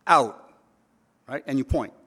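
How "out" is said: sharp loud one-word command; firm shout. Retold or imitated. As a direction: bark it at the dog like an order. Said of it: "One word".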